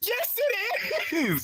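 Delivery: very high, drawn-out yell; shouted insistence. Real, not acted. Player insisting the recalibration is real, shouted insistence. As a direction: yell it back, stretching every word. Shouting back in the same quarrel.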